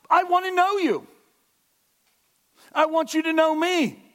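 loud, high, fervent preaching; preaching at full force. Acted. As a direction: drive it home to the congregation.

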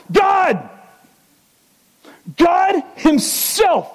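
high, ringing shout of God's name, drawn out slow; preacher's full-voiced, slow proclamation; proclaiming shout. Real, not acted. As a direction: cry it out like a revelation from the pulpit.

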